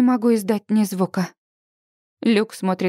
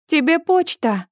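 raised voice calling; 7 semitones higher than usual. Acted. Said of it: "кричу я".

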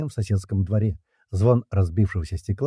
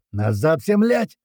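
heated battle shout; 7 semitones higher, 4 dB louder, slower than usual. A narrator's reading. Riflemen yelling retreat in the firefight, heated shout. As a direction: order climbing to a high yell. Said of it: "ответные крики разгорячённых боем стрельцов".

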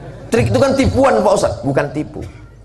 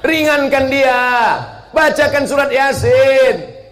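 urgent call; 13 semitones higher, 5 dB louder, slower than usual. Acted. Urgent pleading command.

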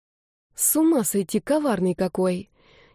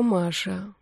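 Маша dropped much softer; softer Маша, a sad shake of the head; quiet; 6 dB quieter than usual. A narrator's reading. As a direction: let the second Маша fall to a near hush. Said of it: "сказал негромко".

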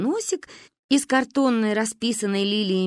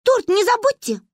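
put-on high, louder worried child's reminder; anxious reminder; 14 semitones higher, 5 dB louder than usual. A narrator's reading. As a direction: fret about the cake. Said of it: "забеспокоилась Манюня".

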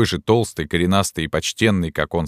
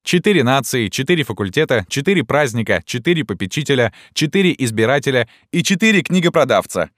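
mocking list; 6 semitones higher than usual. A narrator's reading. Students reel off a mocking list.

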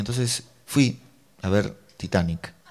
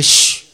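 loud shush; imitated shushing; 9 dB louder than usual. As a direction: shush them back forcefully. El narrador manda callar. (chistido fuerte imitado)

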